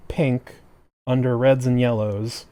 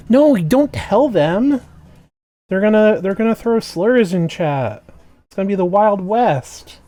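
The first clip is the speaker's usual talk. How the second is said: mock alarmed protest; 8 semitones higher, 5 dB louder than usual. Mock protest against behave request.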